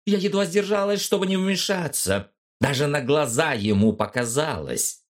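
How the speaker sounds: put-on high, bright upset girl; a girl blurting her worry, high; excited young woman. Mia continues, upset.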